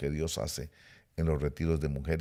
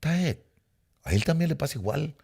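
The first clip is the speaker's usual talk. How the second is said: higher, amazed exclamation; surprised realization; 8 semitones higher than usual. Retold or imitated. Preacher recalls finding his pastor doubts too, amazed. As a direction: gasp out the discovery. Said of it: "dije yo".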